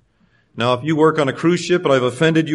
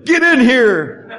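higher, slow, laughing call; eager, laughing; 7 semitones higher, 4 dB louder, much slower than usual. Retold or imitated. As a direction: pull him into the boat gladly. Disciples pulling Jesus aboard.

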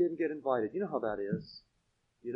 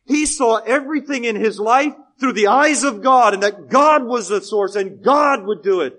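loud, higher-pitched sermon proclamation; forceful preaching; 7 semitones higher, 12 dB louder than usual. Real, not acted. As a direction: proclaim it to the whole congregation.

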